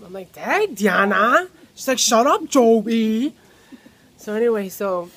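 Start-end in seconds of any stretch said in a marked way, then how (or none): 0.3-1.5 loud drawn-out call; teasing outburst, drawn out; mock exclamation. Teasing exclamation in retold exchange. 2.0-3.3 loud, drawn-out mock yell; aunt's yell. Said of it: "yelling at me".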